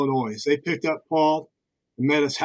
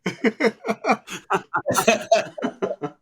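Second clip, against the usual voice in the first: laughing exclamation; 8 semitones higher than usual. Real, not acted. Laughing at old men joke.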